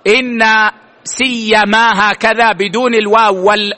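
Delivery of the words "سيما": سيما alone, slow and drawn out; pronunciation demo. Shown as a demonstration. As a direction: model سيما slowly for the class. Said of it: "وأخطأ من قال". (نطق سيما وحدها ببطء)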